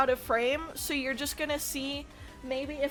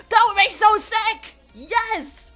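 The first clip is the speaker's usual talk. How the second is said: loud excited shout; excited yell; 8 semitones higher, 11 dB louder, slower than usual. Real, not acted. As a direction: yell it with delight. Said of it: "Sorry, I didn't mean to yell".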